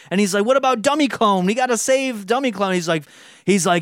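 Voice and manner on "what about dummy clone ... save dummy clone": high-pitched worried objection; mock distressed, higher voice; pleading protest. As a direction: plead for your friend, voice raised in pitch. Sensitive clone protests in retold argument.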